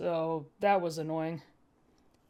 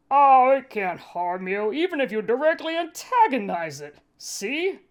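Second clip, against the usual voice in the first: a hint of gloating villain; smug Cad; 4 semitones higher, 5 dB louder than usual. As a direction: show off cruel power. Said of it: "Cad showing off power".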